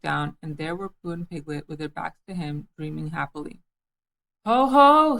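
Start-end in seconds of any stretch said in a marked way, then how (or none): 4.4-5.2 booming, startling shout; loud sudden "Ho, ho!". A narrator's reading. Christopher Robin startling Pooh and Piglet, loud shout. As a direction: burst out to startle the dreamers. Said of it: "said Christopher Robin loudly and suddenly".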